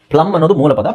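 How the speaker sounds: ordinary delivery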